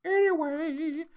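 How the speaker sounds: very high, slow, squeaky anyway. Acted. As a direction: stretch it out in a falsetto squeak. Silly drawn-out anyway after laughing.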